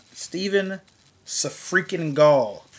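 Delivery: Sa-freaking-Gall spat out slowly; mocking name. Real, not acted. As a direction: drag the name out with scorn.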